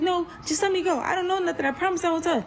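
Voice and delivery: high-pitched desperate begging; panicked pleading. Retold or imitated. Begging the man to let her go, high and panicky. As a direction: re-enact frantic bargaining in a high voice. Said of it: "so I'm panicking now".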